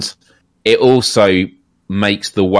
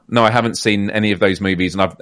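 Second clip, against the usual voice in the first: ordinary talk, nothing marked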